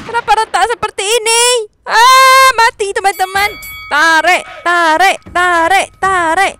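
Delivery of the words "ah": loud high dismayed cry; dismayed shout. Real, not acted. Character dies in game.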